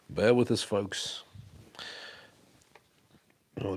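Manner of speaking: normal talking voice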